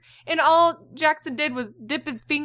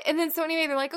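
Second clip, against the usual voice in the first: ordinary delivery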